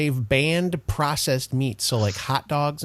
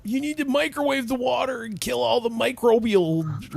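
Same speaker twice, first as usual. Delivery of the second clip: nagging know-it-all voice; 10 semitones higher than usual. Retold or imitated. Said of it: "everybody's like".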